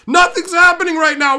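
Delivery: high, loud yell of Nothing's; yelling at the people screaming behind him; angry shout. Retold or imitated. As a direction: shout "Nothing's happening" at them, exasperated. Shouted repeat at screaming group.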